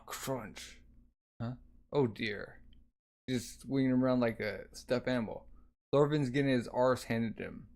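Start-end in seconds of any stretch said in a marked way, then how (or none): none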